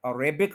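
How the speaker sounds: अरेबिक as "a-RAY-bik"; mispronounced Arabic. Shown as a demonstration. Teacher showing what is wrong, अरेबिक as "a-RAY-bik". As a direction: put the stress on "ray" in अरेबिक. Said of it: "गलत है".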